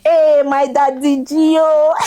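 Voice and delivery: high, loud, drawn-out happy hail; beaming excited shout-out to a dear friend; joyful greeting. Real, not acted. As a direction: call out to him with delight, slowly. Joyfully hailing her friend.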